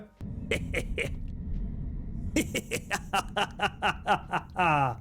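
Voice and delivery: helpless, drawn-out chuckling; real uncontrolled giggling that keeps restarting; long real laughing fit. Real, not acted. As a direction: laugh on and on in stuttering bursts. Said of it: "Why are we laughing".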